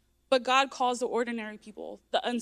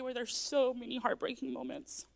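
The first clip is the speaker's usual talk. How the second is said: ordinary delivery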